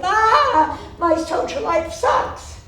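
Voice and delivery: student wailing to her mother on the phone; whiny complaint.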